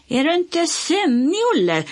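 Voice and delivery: high, loud teasing call; shouting. A narrator's reading. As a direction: call out with a rising tease. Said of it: "skrek Bosse".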